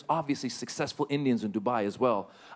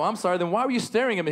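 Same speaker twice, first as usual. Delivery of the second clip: flustered rush; 5 semitones higher, faster than usual. Retold or imitated. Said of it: "I go".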